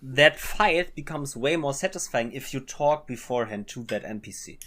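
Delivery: ordinary talk, nothing marked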